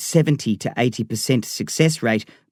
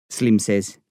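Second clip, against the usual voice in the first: ordinary delivery, nothing marked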